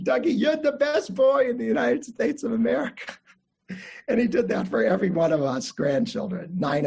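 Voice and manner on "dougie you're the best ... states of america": high-pitched Russian-accented grandfather impression. Grandfather to grandson Dougie, high imitated Russian accent. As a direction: crow it proudly with a Russian accent. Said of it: "say in his Russian accent".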